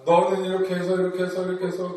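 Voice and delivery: fast, droning parent lecture; lecturing parent. Retold or imitated. Parent lecturing the child, quick and droning. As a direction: rattle off the nagging.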